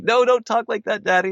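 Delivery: put-on very high little-kid voice; objecting kids. Dad mimics kids rejecting his Scottish voice, high childlike protest. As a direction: squeal it lightly like a child correcting Dad. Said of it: "they're, they're just like".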